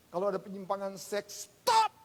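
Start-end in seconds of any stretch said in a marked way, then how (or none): 1.5-1.9 sudden high, loud shout; explosive preacher's shout; shouted command. Real, not acted. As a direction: fire it out as an order. Preacher commands sinners to stop.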